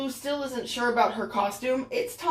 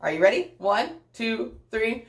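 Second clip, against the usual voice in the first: usual speaking voice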